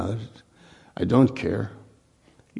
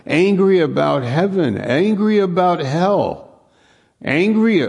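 rising higher and louder to a peak; building intensity; 8 semitones higher, 4 dB louder than usual. Real, not acted. Preacher builds to a loud cry on angry. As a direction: climb each angry until the last one cries out.

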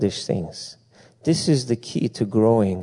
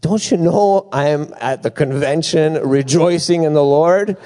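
higher, lightly put-on complaint; mock exasperated self; 5 semitones higher, 3 dB louder than usual. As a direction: retell his own fed-up reply with a grin. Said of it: "and I'm like".

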